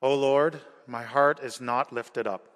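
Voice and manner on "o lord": usual speaking voice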